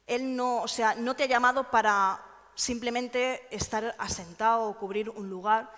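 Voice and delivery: ordinary talk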